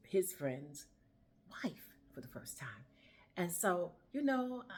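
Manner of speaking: normal talking voice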